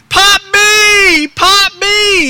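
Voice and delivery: high, loud squealed call; imitated shriek, drawn out; retold child's scream. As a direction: scream it like an excited little granddaughter running up. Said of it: "started running toward me screaming".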